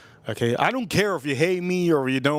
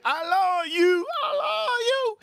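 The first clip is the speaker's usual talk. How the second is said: very high, tearful mock squeal; imitating a woman's high, teary declaration of love; imitating her I love you; slower than usual. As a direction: stretch it up high, almost crying, like she said it. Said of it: "That's how she said it".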